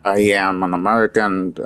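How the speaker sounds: ordinary talk, nothing marked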